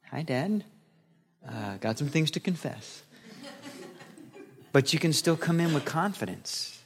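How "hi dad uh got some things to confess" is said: soft, sheepish, embarrassed with a little laugh; sheepish confession. Pretend. Preacher voicing a sheepish child confessing to God, soft and awkward. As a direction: sidle in guiltily. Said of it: "less daddy and more like".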